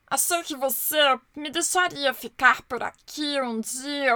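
slow, pleading character voice; sad little word. Acted. O por favor fraco pede abrigo, triste e lento. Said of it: "respondeu o por favor de Duda".

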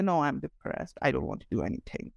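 a lightly hammed-up gloomy moan; mock depressed. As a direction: sulk it out like someone who won't leave the bed. Imitating depressed people in bed.

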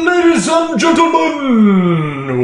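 loud, drawn-out boxing announcer shout; ring announcer. Pretend. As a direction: bellow a fighter introduction, stretching the words. Opens show as ring announcer.

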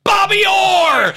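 loud, high, drawn-out shout of the name; triumphant shout. Retold or imitated. Peter's character wins the joke with a loud high shout. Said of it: "Peter Gross turns around and goes".

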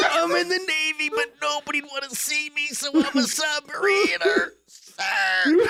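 high-pitched mock military report; hammed-up obedient high voice; reciting recruit. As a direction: imitate a hapless private reporting to an officer. Recruit reporting to visiting colonel.

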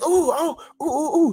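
put-on high, squealing little boy's delight; excited child squeals. Son hears about a surprise toy, squealing high with excitement. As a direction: squeal like a thrilled kid hearing about a toy. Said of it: "He got so excited".

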